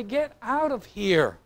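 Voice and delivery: slow, drawn-out get-lost; a pastor slowly shooing off callers; slow annoyed brush-off. Retold or imitated. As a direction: spell out each command slowly, fed up. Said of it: "I'd say".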